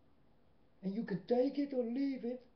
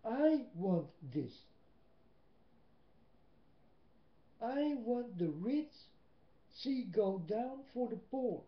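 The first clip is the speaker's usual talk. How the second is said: retold prayer; slower than usual. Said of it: "I did ask my Father God".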